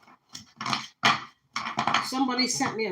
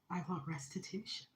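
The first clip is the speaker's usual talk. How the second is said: whispered, much softer; unvoiced whisper of resolve; 3 semitones lower, 14 dB quieter than usual. Real, not acted. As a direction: breathe the words, firm but hushed.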